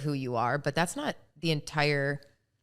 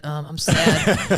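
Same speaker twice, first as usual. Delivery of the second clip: mock sad confession; 7 dB louder, much slower than usual. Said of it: "they're like".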